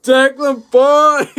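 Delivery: loud, high, delighted call of his name; whooping hello to a friend; excited shout. Real, not acted. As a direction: greet him like a long-lost mate. Excited greeting of friend on stream.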